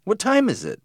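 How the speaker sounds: high-pitched, puzzled question; surprised. A narrator's reading. Boy asking dad the time, surprised. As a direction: lift it high in mild surprise. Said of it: "I asked, surprised".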